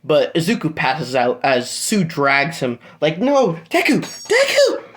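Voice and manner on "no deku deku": high, cracking cry of alarm; desperate cry. Acted. Sue crying out as Deku passes out. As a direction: scream his name as he collapses. Character cries out for injured friend.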